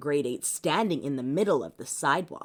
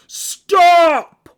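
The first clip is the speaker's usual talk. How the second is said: huge, loud, high shouted command; giant yell; 11 semitones higher, 16 dB louder than usual. Acted. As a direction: stop the traffic with one roar. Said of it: "and yelled".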